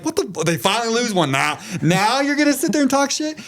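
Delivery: mock indignant outburst. Retelling his reply to the group chat, mock indignant. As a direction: throw it at the group chat, half-joking outrage. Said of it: "I'm like".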